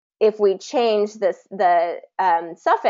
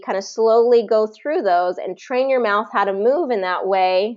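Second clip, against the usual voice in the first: ordinary talk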